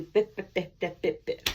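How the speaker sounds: bleep herself out like a censor beep; mock beeping noise. Cutting off her own excuses, loud mock bleeps.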